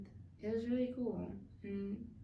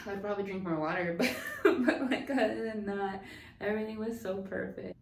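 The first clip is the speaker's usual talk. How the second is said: laughing through the words; joking aside; 5 dB louder than usual. Real, not acted. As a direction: crack yourself up mid-sentence. Laughs at her own joke.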